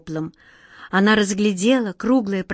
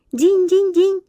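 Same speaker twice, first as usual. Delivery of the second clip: high tinkling chime; chiming; 10 semitones higher, 3 dB louder, slower than usual. Acted. Vikhrik shows Glazastik how smiles ring, chiming. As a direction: ring the syllables like little bells. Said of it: "Вот так".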